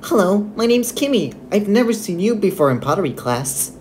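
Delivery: ordinary talk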